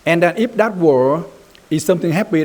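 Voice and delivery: usual speaking voice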